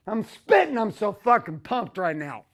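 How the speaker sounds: ordinary delivery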